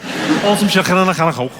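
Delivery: broad, high Scouse with throaty k's; Scouse accent. Shown as a demonstration. Comic warming up with the key phrase, thick Scouse. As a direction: repeat it in thick Liverpool. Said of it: "the phrase I use to do the Scouse accent".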